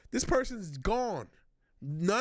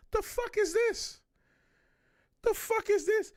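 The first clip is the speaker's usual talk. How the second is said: shocked disbelief; 12 semitones higher than usual. Real, not acted. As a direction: recoil in disbelief. Shocked by fat suit.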